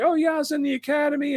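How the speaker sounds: high-pitched mock drunk kid. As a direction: imitate the eager tipsy probie brushing it off.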